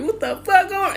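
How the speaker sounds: baffled. Retold or imitated. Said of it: "I'm like".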